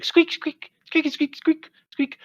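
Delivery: very high chorus of squeaks; squeaking ermines. Acted. The other ermines chiming in, very high squeaks. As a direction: chirp the squeaks like a crowd of tiny ermines. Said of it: "everyone else is saying".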